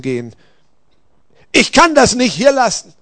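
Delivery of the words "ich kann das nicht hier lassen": high, loud protest; agitated warrior's outcry; distressed refusal. A narrator's reading. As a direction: refuse desperately with raised voice. Warrior refuses to give up spear.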